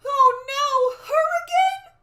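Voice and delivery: very high, loud panicked cry; squealed storybook alarm; alarmed dismay. Acted. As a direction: exclaim it in theatrical dread. Jack dismayed at soothsayer.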